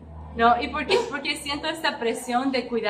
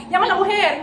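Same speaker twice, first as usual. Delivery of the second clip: higher, louder royal order; commanding king; 6 semitones higher, 7 dB louder, faster than usual. Retold or imitated. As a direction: give the order like an impulsive king. David's impulsive command retold.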